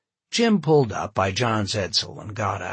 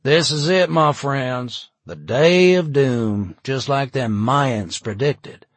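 loud, higher doomsday proclamation; raised voice; 4 semitones higher, 7 dB louder, slower than usual. A narrator's reading. Jim warns friends of the day of doom, voice raised. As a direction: proclaim it to the crowd, drawing out the doom. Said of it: "He raised his voice a bit".

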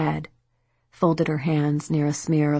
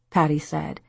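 ordinary delivery

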